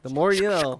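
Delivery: playful announcer jingle voice; mock PSA slogan. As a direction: sing out the slogan like a TV spot.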